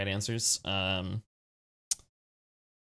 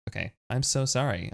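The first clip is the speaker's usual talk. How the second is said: ordinary talk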